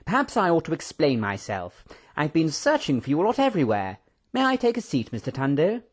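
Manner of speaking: lightly voiced, lilting old friend; excitable professor. Professor asking to sit, eager lilt. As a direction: chatter on with a rising lilt.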